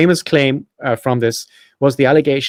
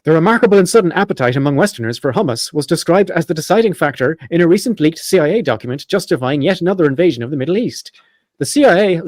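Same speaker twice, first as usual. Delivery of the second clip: higher-pitched parody newsreader; mock news read; 4 semitones higher than usual. Host reads his satirical hummus article, mock news read. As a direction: read it like an earnest news bulletin.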